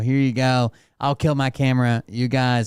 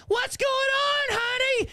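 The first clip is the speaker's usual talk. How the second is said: very high, drawn-out holler; hammed-up shrieking neighbor lady; calling out; slower than usual. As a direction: holler to a kid across the yard.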